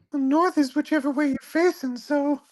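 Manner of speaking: very high-pitched cartoon character; tiny high character voice; Bitsy voice. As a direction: put on a high squeaky little voice. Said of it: "a second to do Bitsy".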